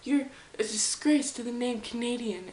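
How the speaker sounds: loud, high indignant scolding; hammed-up appalled patriot, loudly berating; mock outrage. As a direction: scold them in exaggerated shock and outrage. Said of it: "sometimes you're like".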